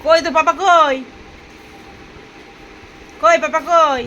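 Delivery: loud, searching call across the room. Real, not acted. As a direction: call out loudly for the pet. Calling out for the pet.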